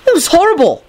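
very high, indignant outburst; heated. A narrator's reading. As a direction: exclaim it in shrieky outrage. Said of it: "Neville said heatedly".

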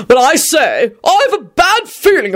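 high-pitched, ominous character voice; dark foreboding. A narrator's reading. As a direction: voice a gentleman's grave misgiving. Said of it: "Richard said darkly".

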